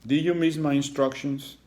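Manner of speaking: high, loud stern question; looming strict principal. Acted. Principal looms over Iggy.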